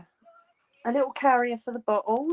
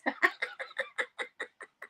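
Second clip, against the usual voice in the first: squeaky laugh; 7 dB quieter than usual. Real, not acted.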